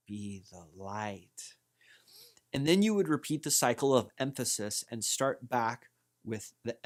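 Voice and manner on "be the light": slow, low mantra, lingering on "light"; stress on LIGHT. Shown as a demonstration. Teacher shows flowing mantra emphasis, stress on "light". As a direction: run gently into a long, drawn-out "light". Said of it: "then the and then the light".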